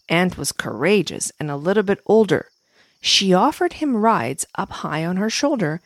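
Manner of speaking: usual speaking voice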